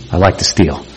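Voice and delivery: soft, low repeat of "I like to steal."; quiet confession. Retold or imitated. Troubled youth quietly repeating "I like to steal.". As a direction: let "I like to steal." drop low and hushed. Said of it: "He said".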